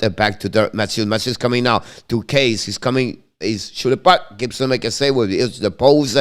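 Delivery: running hockey commentary, steady and brisk; live play-by-play. Acted. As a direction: call the game as it happens.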